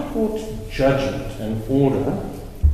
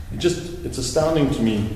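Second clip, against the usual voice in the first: ordinary talk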